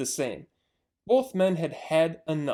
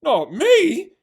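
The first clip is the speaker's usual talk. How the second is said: high, loud panicked shout; scream; 11 semitones higher, 10 dB louder than usual. Acted. Bunce refusing to go down the hole, loud scream. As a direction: scream it and run. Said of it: "screamed Bunce".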